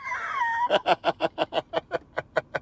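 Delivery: screaming with laughter. Real, not acted. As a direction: burst out in a howling laugh. Howling at corn history.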